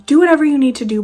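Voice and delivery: usual speaking voice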